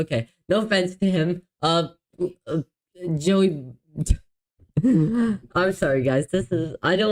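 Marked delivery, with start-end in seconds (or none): none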